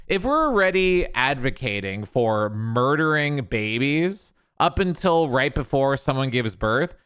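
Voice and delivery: normal talking voice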